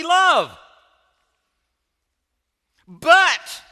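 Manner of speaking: loud, high, drawn-out shouted reproach; hurt outrage, hammering each phrase slowly; angry accusing Martha. Acted. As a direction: yell it as a furious accusation. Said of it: "Here's how she said it".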